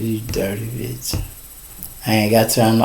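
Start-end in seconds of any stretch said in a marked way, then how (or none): 0.0-1.2 muttered curse. Retold or imitated. Man cursing his late side chick, soft muttered insult. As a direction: mutter it under your breath.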